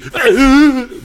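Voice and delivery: loud, very high squeal of fright; fear squeal. Retold or imitated. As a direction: shriek as if a car is crashing in. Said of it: "volví a hacer mi grito de miedo".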